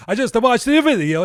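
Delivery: high, loud imitation of a fussy dad; mock dad voice.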